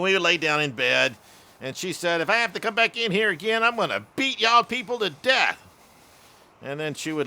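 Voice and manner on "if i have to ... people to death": higher-pitched imitation of a scolding mom; mock angry threat. Exasperated mom warning two giggling boys, mock angry threat. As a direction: scold in a pitched-up, half-serious rant.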